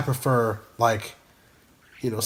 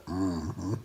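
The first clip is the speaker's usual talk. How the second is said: Sling Blade grunt; 6 semitones lower, 8 dB quieter than usual. Retold or imitated. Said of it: "That's freaky".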